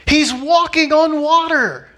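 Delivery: high, slow, drawn-out amazement; incredulous exclamation. Real, not acted. Preacher stressing Peter's miracle to the congregation, high incredulous cry. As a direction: marvel loudly at the absurdity of doubting.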